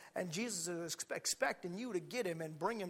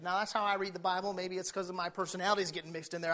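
usual speaking voice

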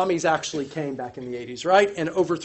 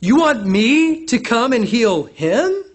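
slow, higher, louder disbelief; incredulous question; 6 semitones higher, 7 dB louder, slower than usual. Pretend. Preacher voicing Jesus' surprised question. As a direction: ask it as if it's unthinkable. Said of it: "Jesus' question thus places".

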